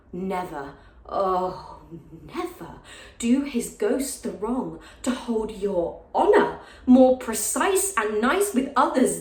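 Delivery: halting start, swelling into pained reproach; Lady Percy, wounded reproach. Acted. Lady Percy urging him to let the allies be, wounded and bitter. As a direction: hold back, then let the regret swell on the last phrases. Performs end of the monologue.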